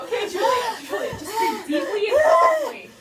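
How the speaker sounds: high, loud urgent coaching, laughing through it; mock urgent doctor. Doctor coaching a screaming patient, loud and half-laughing. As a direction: shout calming orders while cracking up.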